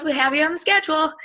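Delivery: high-pitched imitation of a scheduler; chirpy retold organizer. Organizer replying to the nervous speaker, mock-chirpy. Lady's dreaded reply, mimicked.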